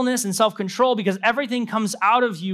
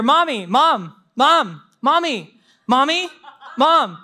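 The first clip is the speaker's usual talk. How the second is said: put-on higher, nagging kid calling over and over; child calling; 5 semitones higher, slower than usual. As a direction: whine for mom like a toddler. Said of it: "you hear".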